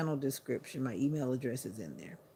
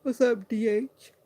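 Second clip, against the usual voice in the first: excited greeting; 7 semitones higher, 9 dB louder than usual. Real, not acted. Calling out a greeting to viewer.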